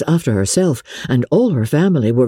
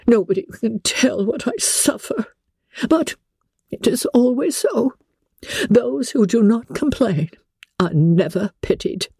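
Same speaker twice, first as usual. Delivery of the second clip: high, plaintive, a touch of a sob; mother wallowing in her sufferings; tearful complaint; 9 semitones higher than usual. A narrator's reading. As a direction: Mrs Bennet lamenting, near tears. Mrs Bennet laments.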